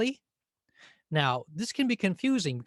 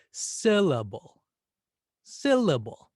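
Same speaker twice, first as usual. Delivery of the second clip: "Syllable" with SYL punched loud and long; exaggerated first-syllable stress; 5 dB louder, slower than usual. Shown as a demonstration. Teacher testing each syllable for stress, exaggerated SYL-la-ble. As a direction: lean hard on the first syllable of "Syllable". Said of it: "We'll exaggerate it".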